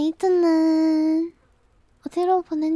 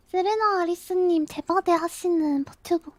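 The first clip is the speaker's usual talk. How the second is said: ordinary talk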